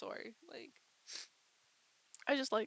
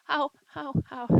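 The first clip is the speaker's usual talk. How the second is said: ow; 5 semitones higher, 10 dB louder than usual. Real, not acted. Hurt, crying out.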